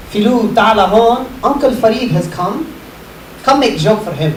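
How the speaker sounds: put-on light Lebanese accent, calling out; father's accent, calling his son. Father calls son over.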